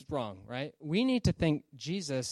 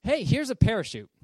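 higher, louder upbeat sales pitch; flight attendant's pitch; 7 semitones higher, 7 dB louder than usual. Acted. Flight attendant overselling a parachute to a passenger, eager pitch. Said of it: "guy is told by the flight attendant".

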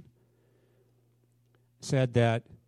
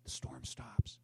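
whispered, much softer and lower; sudden whisper; 6 semitones lower, 13 dB quieter, slower than usual. Acted. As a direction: drop to a hush as the storm stops. Dramatic hush after storm.

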